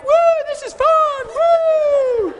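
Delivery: high, loud party cheering; gleeful whoop. Retold or imitated. Coworkers cheering about throwing poop at the fan, high gleeful whoops. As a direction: whoop it up like a rowdy crowd.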